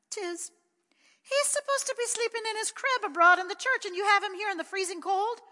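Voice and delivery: put-on high, loud child's voice; a small boy, shocked and loud; high-pitched astonished child; little boy Pat. A narrator's reading. Little Pat questions his sister.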